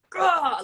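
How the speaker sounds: high, loud growl of frustration; exasperated scream. Retold or imitated. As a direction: let out an imitated exasperated outburst. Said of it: "friends were just like".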